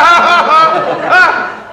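high, loud burst of laughter; big scoffing guffaw. Acted. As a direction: burst out laughing at the absurd promise. Reacts to promise of demolition.

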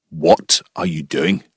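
low, slow Scottish challenge, word by word; stern Scottish farmer. Retold or imitated. Farmer confronts the praying intruder, stern slow Scottish accent. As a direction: make the intruder feel unwelcome in a deep Scottish accent. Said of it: "he made it pretty clear".

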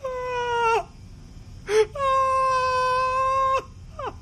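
high keening sobs rising to a cry; mother's wailing sob. Acted. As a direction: wail as she collapses weeping. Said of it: "sinks to her knees and weeps".

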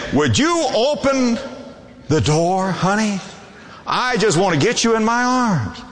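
high, lifted wooing voice; coaxing husband. Acted. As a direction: sweet-talk her through the door. Voicing husband coaxing his wife.

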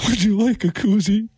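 high, tearful mock offer; put-on weepy squeak; imitated crying. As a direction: impersonate someone being polite through sobs. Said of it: "And then she starts crying".

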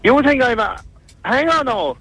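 ordinary talk

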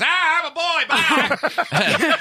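mocking impression. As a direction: sends up a clumsy flirt in a silly high voice. Mocking Kelly's clunky pickup line.

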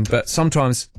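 normal talking voice

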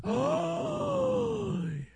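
big, high theatrical gasp; exaggerated intake of shock; mock horrified gasp. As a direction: gasp as if scandalized. Mimicking shocked online reaction.

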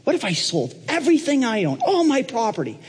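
high, rushing, eager pitch; eager Trump. Acted. Trump interrupting Paul to offer his property, eager. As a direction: Trump jumping in to offer everything, high and keen. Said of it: "Donald just jumps right in again".

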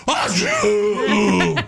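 high, mock "achoo" sneeze; dainty sneeze. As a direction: ham up a prissy sneeze. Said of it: "somebody that's like".

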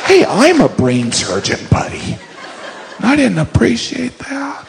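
slow, high, wounded voice; offended brain surgeon. Acted. Brain surgeon objecting to the joke, slow high offended voice. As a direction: draw it out high and affronted.

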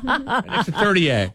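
high-pitched eager explanation; excited caller. Acted. Caller explaining the place to radio hosts, excited. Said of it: "he's very excited".

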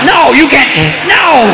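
high, sharp "No, you can't"; shouted refusal. Acted. Comic refusing the Texans, yelling back high. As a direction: shut them down with a yelled no.

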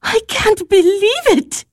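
high-pitched amazed disbelief; astonished. Acted. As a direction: exclaim in disbelief, pitch jumping up. Astonished at news of appointment.

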